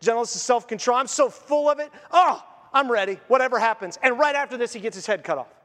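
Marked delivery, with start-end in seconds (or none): 0.0-4.0 bubbling over with higher, louder joy; an excited preacher imitating an overjoyed prophet; joyful John. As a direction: play John as giddy and ready for anything. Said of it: "He's like".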